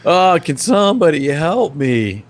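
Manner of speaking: lost customer plea. Retold or imitated. Lost shopper in huge aisles calling for help, slow and pleading.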